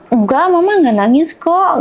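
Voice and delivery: put-on high, reassuring voice to a child; retold motherly denial. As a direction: act out reassuring a small child. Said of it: "gitu, gitu".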